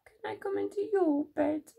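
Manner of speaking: high little-mouse request; pleading Little Mouse. A narrator's reading. Little Mouse asks Big Mouse to share, high pleading. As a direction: ask small and high, hopefully. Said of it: "asked Little Mouse".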